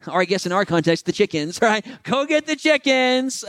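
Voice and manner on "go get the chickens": higher, slow exasperated call; mock weary husband. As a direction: drag it out like a tired sinner. Preacher acts out sacrifice joke.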